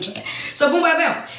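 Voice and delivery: ordinary talk, nothing marked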